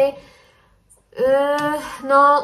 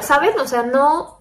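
ordinary delivery

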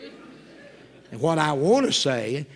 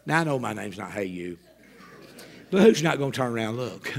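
ordinary delivery

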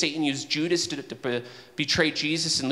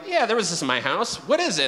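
mock-curious, high and lifted; eager roadshow owner; 6 semitones higher, 4 dB louder, faster than usual. Owner asking the Antique Roadshow appraiser, acted high and eager. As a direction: play an owner keen for the appraisal.

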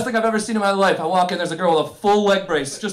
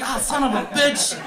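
higher, drawn-out cry of back pain; pained groan; 4 semitones higher than usual. Acted. Aging man's back starts to hurt, mock agony. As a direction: stretch it out like a back spasm.

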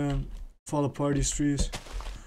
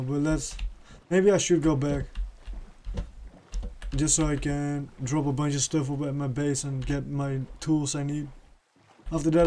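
ordinary talk

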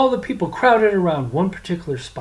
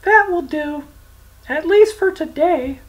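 put-on high, teasing young voice; prince voice; 13 semitones higher, 6 dB louder than usual. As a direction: stop the beating with a smug edge. Francis halts the beating.

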